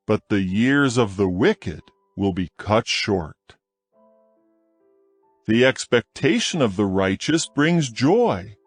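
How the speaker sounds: usual speaking voice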